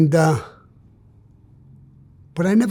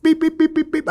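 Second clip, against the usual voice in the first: squeaky high beep beep beep; high rabbit beeps; 12 semitones higher, 4 dB louder than usual. Retold or imitated. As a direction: mimic a frantic rabbit, high and loud. Imitating rabbit noise.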